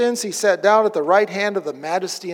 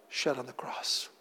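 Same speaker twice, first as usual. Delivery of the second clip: whispered; 7 semitones lower, 16 dB quieter than usual. Real, not acted. Sermon climax on the cross.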